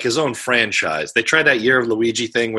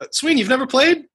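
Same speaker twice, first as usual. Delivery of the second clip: surprised; 14 semitones higher than usual. Real, not acted. Surprised nobody played Katamari.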